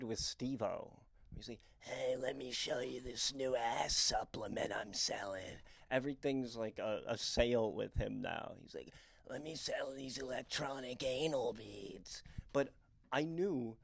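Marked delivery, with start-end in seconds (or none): 1.8-5.6 whispery rasped Steve-O voice; breathy, strained Steve-O sales pitch; Steve-O impression. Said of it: "the Steve-O impression's killing my throat chakra". 9.3-12.2 softer strained Steve-O voice; Steve-O impression. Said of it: "the Steve-O impression's killing my throat chakra".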